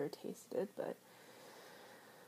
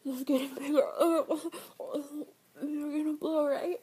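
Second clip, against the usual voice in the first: excited; 5 semitones higher, 6 dB louder than usual. Acted. Excited climax roleplay.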